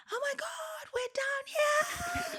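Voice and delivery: high, drawn-out mock scream at no great volume; retold scream. Friend retelling a woman screaming to the group, mock scream. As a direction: imitate someone screaming from far along the beach, voice way up high. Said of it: "I just heard someone screaming".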